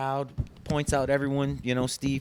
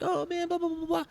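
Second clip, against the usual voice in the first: much higher gushing babble; mock crowd cheer; 4 dB quieter than usual. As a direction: gush like an excited crowd. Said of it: "Everyone's like".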